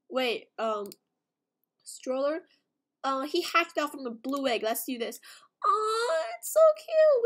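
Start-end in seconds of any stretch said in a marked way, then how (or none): 5.7-7.2 high, loud, teary squeal over cuteness; high, loud gush on the edge of tears; overwhelmed squeal. Real, not acted. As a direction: cry it out, overwhelmed by how cute. Overwhelmed by her cute pet.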